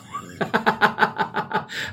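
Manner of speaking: breathless high-pitched laugh; high wheezy laugh. Real, not acted. Laughing at a DM saying the podcast sucks. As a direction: burst out laughing.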